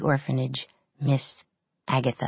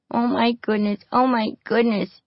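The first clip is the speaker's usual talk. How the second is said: high, loud whiny fretting; whining; 7 semitones higher, 4 dB louder than usual. A narrator's reading. Frightened little girl whines about the fight, high and loud.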